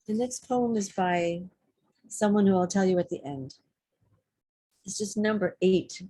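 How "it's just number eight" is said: ordinary talk, nothing marked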